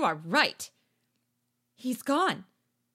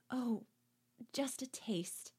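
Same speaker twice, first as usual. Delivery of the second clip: whisper; 2 semitones higher, 10 dB quieter than usual. Acted. Girl musing alone at dusk, a soft whisper. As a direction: whisper it dreamily. Sylvette muses softly.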